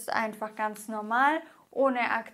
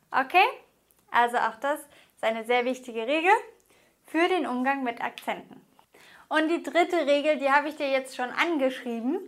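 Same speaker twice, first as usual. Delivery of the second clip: ordinary talk, nothing marked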